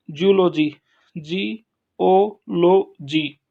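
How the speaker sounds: Zoology said loud, then जी ओ लो जी very slow; stress demo. Shown as a demonstration. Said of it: "तीसरे पे आप stress लगा सकते हैं".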